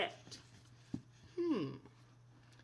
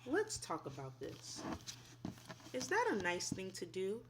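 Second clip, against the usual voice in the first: soft, gentle lesson voice; gentle teacher talk; 3 semitones lower, 8 dB quieter than usual. Acted. Teacher to children about manners, gentle. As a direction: talk it through kindly with the class.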